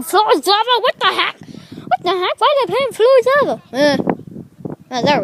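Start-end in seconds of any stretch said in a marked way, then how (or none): 0.0-3.6 high, loud, incredulous outburst; baffled disbelief. Real, not acted. Seeing friends' weird game, baffled disbelief. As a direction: exclaim it, pitch climbing in disbelief.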